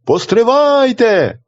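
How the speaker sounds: a high, slow, drawn-out call; calling from afar. A narrator's reading. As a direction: call out to stop the executioners from far away. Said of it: "як один гукає здалеку". (вершник гукає здалеку: протяжний високий оклик)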